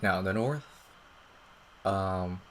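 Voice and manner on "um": ordinary talk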